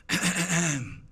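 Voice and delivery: preparatory "ahem" of a nervous reader; stagey throat clearing. Retold or imitated. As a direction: clear your throat before reading out. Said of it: "It went something like this".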